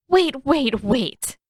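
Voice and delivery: interrupting. Acted. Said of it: "Katara interrupted".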